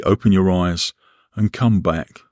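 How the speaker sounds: ordinary talk, nothing marked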